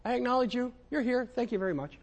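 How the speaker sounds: dismissive prayer parody; mock casual thanks. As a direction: brush God off politely. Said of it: "That doesn't mean".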